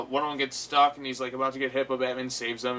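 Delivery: normal talking voice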